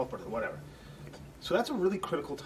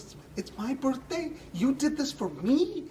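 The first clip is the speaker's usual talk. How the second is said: put-on much higher, squealing kid voice, louder at the end; imitating an excited kid; 12 semitones higher, 3 dB louder than usual. Little boy stunned by his surprise birthday party, squealing with joy. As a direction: play the overjoyed little boy who can't believe it. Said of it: "this little kid got so excited".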